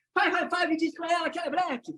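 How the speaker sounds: high-pitched crowd command; rallying call. Shown as a demonstration. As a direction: call it out like a hype trainer. Hyping the audience into exercise.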